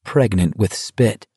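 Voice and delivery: usual speaking voice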